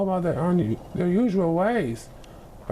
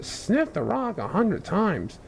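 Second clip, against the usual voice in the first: ordinary delivery, nothing marked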